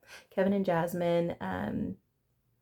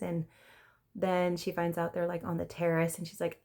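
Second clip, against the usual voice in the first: ordinary talk, nothing marked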